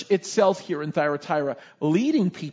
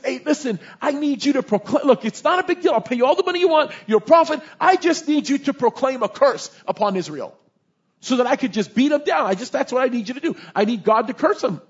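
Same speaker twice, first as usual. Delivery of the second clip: raised, pressing voice; urgent king's pitch; 6 semitones higher, 4 dB louder than usual. Acted. King hiring a prophet to curse Israel, urgent. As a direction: push the request hard, higher and louder.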